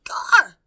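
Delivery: very loud, high yelp; startled cry. A narrator's reading. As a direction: cry out in shock. Meg's cry.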